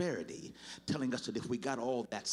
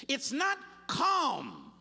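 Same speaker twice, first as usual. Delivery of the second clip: loud and slow; 5 dB louder, much slower than usual. Real, not acted. Preacher at full force.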